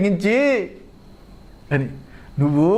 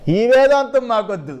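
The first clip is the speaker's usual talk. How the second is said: indignant protest; 5 semitones higher than usual. Acted. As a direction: reject it outright as the fed-up objector. Objector's acted protest. (విసిగిపోయి గట్టిగా నిరాకరణ)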